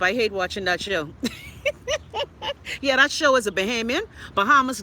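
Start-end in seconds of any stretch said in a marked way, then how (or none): 1.1-2.7 high, light giggle; laugh. Real, not acted. Laughing to viewers about hating that show, high giggle. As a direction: burst into a high-pitched giggle.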